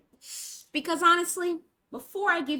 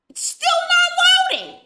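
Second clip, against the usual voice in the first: rising much higher and louder in disbelief; exasperated; 11 dB louder than usual. Real, not acted. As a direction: climb into a squeaky fed-up cry. Exasperated about loading.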